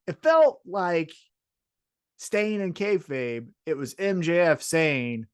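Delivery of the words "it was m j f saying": ordinary talk, nothing marked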